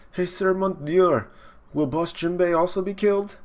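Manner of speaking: high cartoon minion; questioning underling. Underling voiced asking superior.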